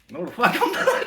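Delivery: high, loud outraged refusal through laughter; mock shout laughing. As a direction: yell it back, laughing. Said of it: "And I was like".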